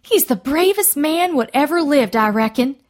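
high-pitched, admiring exclamation; excited cry. A narrator's reading. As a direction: gush it out in excitement. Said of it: "Ruby May cried".

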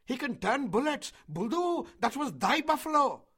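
high, loud accusing yells; villagers shouting. A narrator's reading. Villagers blaming Mowgli's magic for the shot, shouting. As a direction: shout it across at Buldeo. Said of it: "shouted the villagers".

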